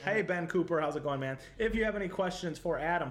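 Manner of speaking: ordinary talk, nothing marked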